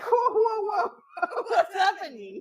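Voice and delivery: high, laughing whoa; laughing disbelief. Retold or imitated. Reacting to a flood of emoji texts, laughing in disbelief. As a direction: laugh in mock alarm. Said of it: "And it's like".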